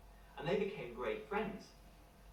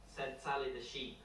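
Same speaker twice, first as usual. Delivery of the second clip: ordinary delivery, nothing marked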